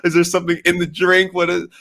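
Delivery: high, loud, alarmed voicing of the chat; a crowd of worried fans yelling at the screen; chat freaking out. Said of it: "Everybody's in the chat just freaking out".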